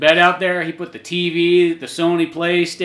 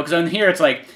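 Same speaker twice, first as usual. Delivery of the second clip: ordinary talk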